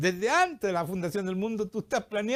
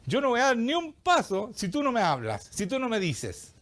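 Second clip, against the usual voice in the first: insistent, raised-pitch ultimatum to God; insistent plea; 6 semitones higher than usual. Acted. Believer refusing to move until the Lord speaks, insistent plea. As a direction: plead firmly, voice lifted, stretching the last words. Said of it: "Cuando tú digas, "Señor".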